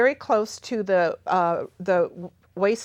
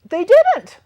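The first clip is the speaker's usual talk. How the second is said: much higher and louder, punching didnt; emphatic repeat; 11 dB louder than usual. Real, not acted. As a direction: hammer the denial home, almost a shout. Repeats denial more forcefully.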